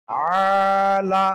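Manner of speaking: আলা with the alif stretched very long and slow. Shown as a demonstration. Teacher stretching the alif in আলা for the class. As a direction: draw out the aa in আলা. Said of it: "একালিফ টান হবে".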